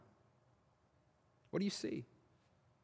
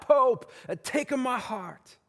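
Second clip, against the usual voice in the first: lightly hammed Italian-accented plea, higher pitched; 7 semitones higher, slower than usual. Crowd begging the Pope for his heart, in a mock Italian accent. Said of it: "that's the best Italian accent I've got".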